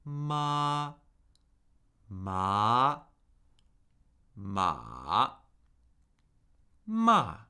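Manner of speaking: teacher's slow "ma" tone drill for repeating; four Mandarin tones. Shown as a demonstration. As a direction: model "ma" in four tones, pausing between each. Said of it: "You can repeat after me".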